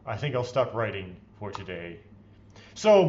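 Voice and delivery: usual speaking voice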